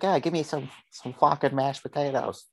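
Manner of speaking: mock a New Jersey guy demanding mashed potatoes; New Jersey accent. Mocking a New Jersey guy craving potatoes, in a put-on New Jersey accent. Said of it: "I don't know what accent that was. It was, like, New Jersey".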